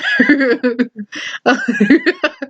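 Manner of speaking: laughing at oneself; real laugh at own mistake; amused laugh. Real, not acted.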